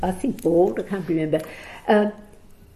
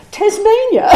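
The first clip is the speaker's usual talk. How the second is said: much higher and louder, with a laugh; voice going higher; 8 dB louder than usual. Retold or imitated. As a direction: squeak the word out in delight. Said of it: "his voice going higher".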